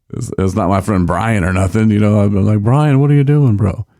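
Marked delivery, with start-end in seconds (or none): 2.6-3.9 higher, louder call-out to a buddy; mock exasperated. Speaker imagining scolding a buddy, mock exasperated. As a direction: playfully take a friend to task.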